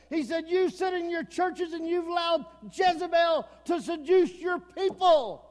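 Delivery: loud, driving revival preaching; preaching at full force. Acted. Preacher warning a lukewarm congregation, loud and driving. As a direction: thunder it from the pulpit like a revival preacher.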